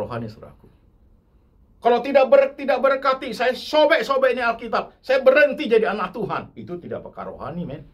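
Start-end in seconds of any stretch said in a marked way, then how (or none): 1.8-6.5 high, loud ultimatum to God; the preacher mocks a believer threatening God in prayer. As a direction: threaten God like a sulking bully. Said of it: "wah, main ngancam".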